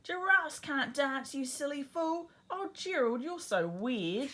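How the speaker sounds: high, loud mocking taunt; high schoolyard taunt, slowed and loud; sneering taunt. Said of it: "the animals all sneered".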